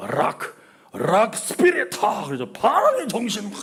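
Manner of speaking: mock rocker. Mimicking son's rock spirit.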